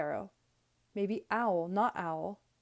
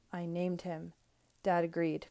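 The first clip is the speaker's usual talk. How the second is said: usual speaking voice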